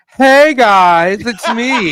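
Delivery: drawn-out, loud cutesy greeting; mock serial-killer letter voice; goofy killer's letter. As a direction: read it as a silly, chummy killer. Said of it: "I'm gonna read it in the voice".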